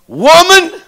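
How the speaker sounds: loud, high preached call; commanding call. Acted. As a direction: ring out the word. Jesus repeated address, climax.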